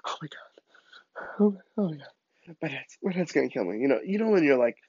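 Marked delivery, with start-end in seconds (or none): 0.1-3.8 hushed, breath-catching panic; quiet freaking out. Retold or imitated. Boy alone on his bed dreading dad, acted panic. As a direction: mutter in mounting dread. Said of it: "kinda freaking out at this point".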